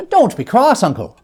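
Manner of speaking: put-on high, coaxing young voice; cheerful nephew. Nephew coaxing his cross uncle, high and light. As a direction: lift the voice and coax him.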